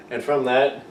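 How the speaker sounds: ordinary delivery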